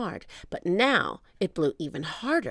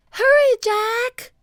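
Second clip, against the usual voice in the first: very high, loud, slow call; urgent high girl's cry; 9 dB louder, slower than usual. A narrator's reading. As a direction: call from the ladder. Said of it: "cried Annie".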